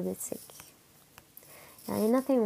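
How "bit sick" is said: slow whispered confession; soft whisper. Real, not acted. Sick streamer whispering to a worried viewer.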